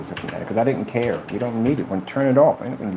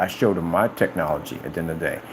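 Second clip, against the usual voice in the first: ordinary talk, nothing marked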